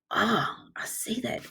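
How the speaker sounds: whispered discovery. Acted. Teacher spotting the exclamation mark for the kids, whispering. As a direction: breathe it like a secret find.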